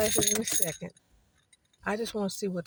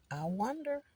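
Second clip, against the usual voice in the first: a soft, higher musing wonder; 5 semitones higher, 4 dB quieter than usual. Shown as a demonstration. Alone in the car, wondering aloud softly. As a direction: muse aloud, half to herself. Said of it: "repeats musing in varied tunes".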